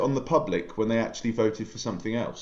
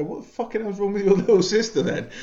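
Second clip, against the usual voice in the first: high, louder incredulous outburst with a laugh; shocked blurt; 10 semitones higher, 4 dB louder than usual. Retold or imitated. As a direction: blurt it out in disbelief, pitched up. Blurting a rude question to friend.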